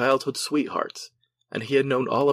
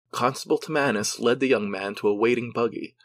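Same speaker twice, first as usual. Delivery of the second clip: ordinary delivery, nothing marked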